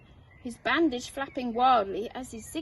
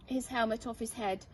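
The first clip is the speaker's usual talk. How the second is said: ordinary delivery, nothing marked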